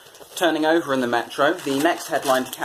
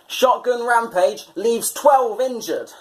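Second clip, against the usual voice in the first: dramatic headline read; 7 semitones higher, slower than usual. Acted. Reading headline aloud.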